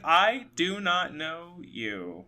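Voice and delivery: slow, spaced-out "I do not know you"; emphatic refusal. Retold or imitated. Woman retold telling the pushy man at her door, "I do not know you", slow and emphatic. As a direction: draw out each word of "I do not know you" firmly.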